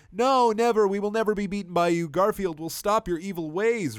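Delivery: high, loud defiant cry; angry defiance. Acted. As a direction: hurl it back in anger. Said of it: "responded the Citadel Council with anger".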